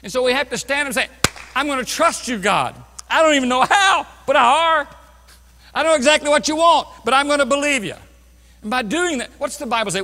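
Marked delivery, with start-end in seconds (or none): 1.5-8.0 fervent declaration. Acted. Acted prayer of trust to God.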